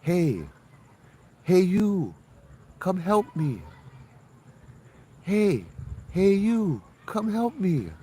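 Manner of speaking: put-on high, thin childlike plea, pitched far up; little girl's voice. Ghost child calling from the dark road behind, high pleading voice. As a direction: imitate a ghostly little girl calling for help. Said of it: "you hear this".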